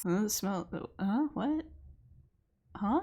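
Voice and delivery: low, quiet, half-asleep mumble; odd sleepy voice. Acted. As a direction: sound like you're barely awake. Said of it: "What is this voice you're doing?".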